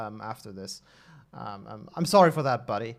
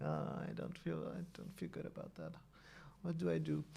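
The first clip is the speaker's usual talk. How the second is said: embarrassed murmur; 18 dB quieter than usual. Real, not acted. Embarrassed after the mistake.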